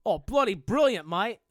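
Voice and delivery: hammed-up Australian accent; Nicky the Aussie. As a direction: answer as a cartoon Aussie bloke. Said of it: "What did Nicky the Aussie think".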